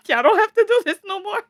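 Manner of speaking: high, loud, quick quote through laughter; laughing retelling. Retold or imitated. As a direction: rush it out while cracking up. Laughing at own retold quote.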